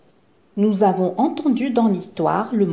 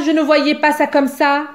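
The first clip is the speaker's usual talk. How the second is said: higher, louder mocking mimicry of the complaint; mocking echo; 5 semitones higher, 4 dB louder than usual. A narrator's reading. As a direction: throw their words back at them. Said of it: "s'insurge Zigomar".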